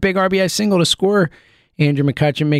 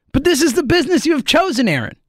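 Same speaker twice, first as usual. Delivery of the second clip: higher, faster, a light Godfather mobster's menace; Hyman Roth style; 8 semitones higher, faster than usual. Retold or imitated. Host addressing Nola as Hyman Roth, lightly voiced mobster. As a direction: lean in like Hyman Roth with a fast, pointed warning. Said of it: "Hyman Roth style".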